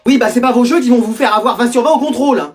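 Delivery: ordinary talk, nothing marked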